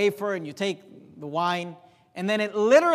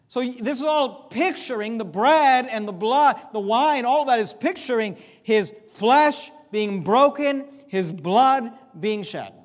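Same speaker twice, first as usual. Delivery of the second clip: raised, louder preaching voice, driving each phrase home; preaching at full force; 3 semitones higher, 5 dB louder, slower than usual. Acted. Pastor driving the point home to his church, preaching at full force. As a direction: preach it hard to the congregation.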